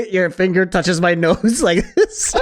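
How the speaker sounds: high, slow laughing; slowed by laughter, a bit high. Real, not acted. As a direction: laugh while describing. Laughing at the barber's mistake.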